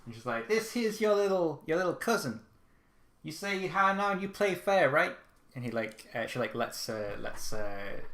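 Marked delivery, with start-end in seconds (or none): 0.5-5.1 higher, louder Southern American aunt introducing the cousin; a proud Southern American auntie making introductions; Southern American accent. Acted. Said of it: "I have always given her a Southern American accent".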